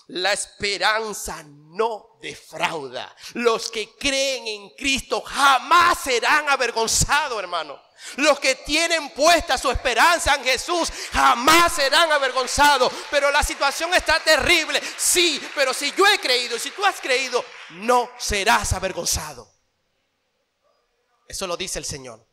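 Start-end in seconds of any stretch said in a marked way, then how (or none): 0.1-3.1 slow emphatic. Acted. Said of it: "¿Y saben algo, mis hermanos?". 3.3-19.5 full-force pulpit preaching, building up; impassioned sermon climax; fiery preaching. Acted.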